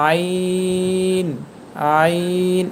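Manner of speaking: আইন stretched from mid-throat; throat letter demo. Shown as a demonstration. Teacher drilling আইন for learners. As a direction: squeeze আইন from the throat slowly. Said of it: "একটা শব্দ আছে দেখেন".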